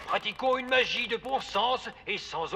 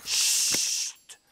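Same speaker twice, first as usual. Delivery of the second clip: whispered hush; library whisper. Acted. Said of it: "nous sommes dans une bibliothèque".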